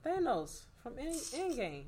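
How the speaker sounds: high-pitched disbelieving explanation; incredulous. Real, not acted. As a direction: say it high, as if it were obvious. Disbelief at unknown film reference.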